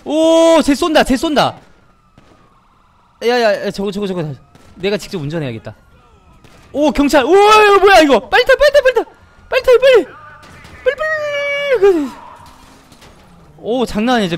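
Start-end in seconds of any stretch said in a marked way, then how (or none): 0.0-4.3 frantic high yelling; panicked shouting. Real, not acted. Fleeing shop, being shot at. 6.7-12.1 very high panicked shrieks; frantic screaming. Real, not acted. Police arrive, urging partner in.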